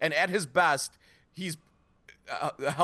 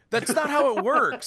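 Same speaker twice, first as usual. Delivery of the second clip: emphatic; 8 semitones higher, 5 dB louder than usual. Real, not acted. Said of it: "blow me".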